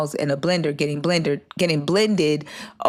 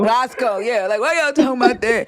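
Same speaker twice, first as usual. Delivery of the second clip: higher, louder whiny stuffed-nose mock; snotty-nosed Rondo; 7 semitones higher, 3 dB louder than usual. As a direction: imitate a scared friend talking through a blocked nose. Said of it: "imagine with the snotty nose and he's saying that".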